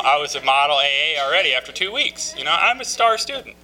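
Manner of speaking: high, loud hammed-up bragging; mock boasting. Recovering drinker mocks his two-week confidence, hammed-up boast. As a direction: crow about it with mock pride. Mocking his early cockiness.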